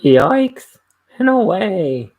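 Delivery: very loud cartoon shout, high; cartoon character voice. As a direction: belt it out like a cartoon hero. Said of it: "cartoon character voice".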